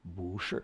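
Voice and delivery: BU sliding up before falling SHI; low-starting BU rising into SHI; rising tone change. Shown as a demonstration. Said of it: "bu going up instead of down".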